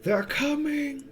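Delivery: high, drawn-out mock yell; guards yelling. A narrator's reading. Guards warning of the approaching undead, high mock yell. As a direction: imitate distant guards calling out at a modest volume. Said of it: "sounds of guards yelling".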